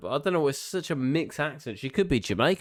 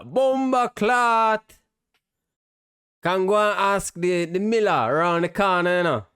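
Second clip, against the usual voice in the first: mock Jamaican accent; 4 semitones higher than usual. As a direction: send up the tavern keeper as a hammed-up Jamaican. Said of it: "sounds like she's got a Caribbean accent".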